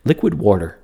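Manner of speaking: "water" with an r in it, "warter"; Neil deGrasse Tyson. Retold or imitated. Fan mimicking a TV scientist's odd "water", r added before the t. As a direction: say "liquid water" as "liquid wawr-ter". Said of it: "we always say".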